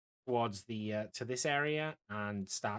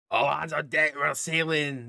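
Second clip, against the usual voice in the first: loud, raised call to the crew; sailor's shout; 4 semitones higher, 8 dB louder than usual. Acted. Crew boss on the top deck calls everyone to sail, shouting. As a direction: shout orders across the deck. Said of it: "uh, shouts".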